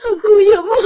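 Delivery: high, tearful cry of panic. Acted. Woman woken by a nightmare tells her sister, crying. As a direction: sob the words out in fear. Panicked after dream of husband.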